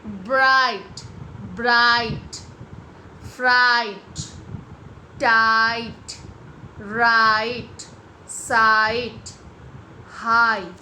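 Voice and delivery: each word loud with a stretched "aai"; long I, silent GH. Shown as a demonstration. Teacher drilling words with silent GH, long loud "aai" from ब्राइट to हाई. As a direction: punch and hold the vowel from ब्राइट to हाई. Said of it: "जीएच का sound किया नहीं".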